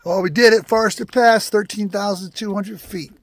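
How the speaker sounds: high, loud summit cheer; excited. Real, not acted. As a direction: shout it out from the top. Reaching the summit.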